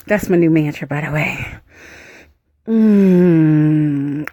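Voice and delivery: usual speaking voice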